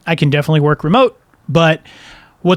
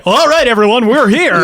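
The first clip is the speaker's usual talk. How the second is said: raised hosting voice; announcer voice; 6 semitones higher, 3 dB louder than usual. Retold or imitated. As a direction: switch into show mode. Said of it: "there's the announcer voice".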